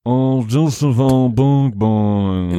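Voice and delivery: slow, drawn-out mock British chef; mildly angry impression, dragged out slowly; Gordon Ramsay. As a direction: put on a British chef, stretching every word. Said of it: "In Gordon's voice".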